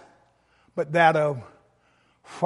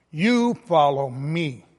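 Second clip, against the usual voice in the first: slow, You said in capitals; capital YOU stress; slower than usual. Shown as a demonstration. Preacher demonstrating the emphatic Greek You. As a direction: spell out the weight on You. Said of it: "but capital Y O U".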